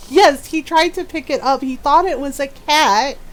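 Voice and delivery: ordinary talk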